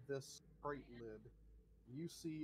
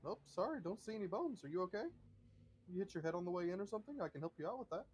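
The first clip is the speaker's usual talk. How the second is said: higher, chipper, polite deflection; oblivious butler; 6 semitones higher, much faster than usual. Acted. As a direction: brush off the claim like a cheerful servant. Butler ignores the bones.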